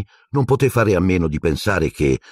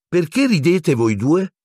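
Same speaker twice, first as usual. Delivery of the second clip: put-on curious girl; 11 semitones higher than usual. A narrator's reading. Hermione walks in on laughing boys.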